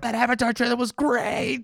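high-pitched mock rave; gushing moviegoers. Moviegoers raving to a complainer, mock gushing.

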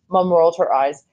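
ordinary delivery

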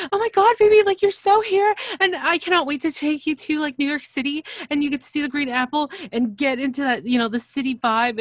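put-on high, loud gushing girlfriend; excited Darcy. Imitating Darcy gushing to Florian about New York, high and loud. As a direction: gush over him, squealing with excitement. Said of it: "she'll be like".

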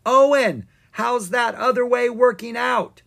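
high, loud taunting call; yelled down. A narrator's reading. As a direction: call it down a hill. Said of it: "and yelled down".

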